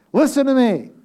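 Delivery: high and loud, calling the room back; loud call to listen. Real, not acted. Preacher calling the laughing congregation back to attention, loud. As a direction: shout it to get their attention.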